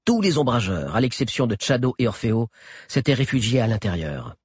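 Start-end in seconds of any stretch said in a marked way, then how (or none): none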